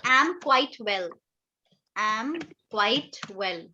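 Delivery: "I am quite well" said very slowly for pupils; slow example sentence. Shown as a demonstration. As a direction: spell out each word slowly. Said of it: "जैसे मैंने बोला".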